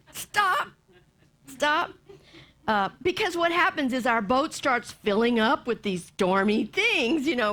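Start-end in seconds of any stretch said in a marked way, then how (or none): 0.1-1.9 high, firm stop. Pretend. Someone telling gossiping friends to stop. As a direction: cut them off firmly. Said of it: "We can say".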